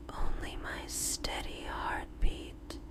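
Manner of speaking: plain reading voice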